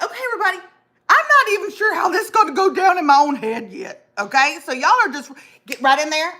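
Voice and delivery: rally the room half-jokingly; herself addressing people. Retold or imitated. Host imitating herself addressing poll workers, mock bossy. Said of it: "And I was like".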